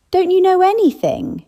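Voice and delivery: can't believe they don't know; incredulous scoff. Acted. Old Edith scoffing at the narrator's ignorance, incredulous.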